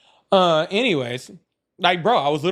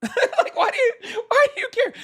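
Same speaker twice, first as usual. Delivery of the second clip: high-pitched laugh through the words; 4 dB louder than usual. Real, not acted. Streamer laughing in disbelief at viewers who fear drag.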